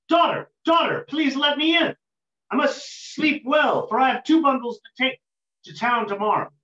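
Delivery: loud, high call to someone inside; calling through the door. Acted. Woodcutter calls to his daughter through the locked door, loud and high. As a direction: raise your voice to be heard through a locked door.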